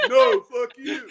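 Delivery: high, loud mock comeback; Landon. Kid snapping back at little gamers, high mock yell. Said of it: "And Landon's like".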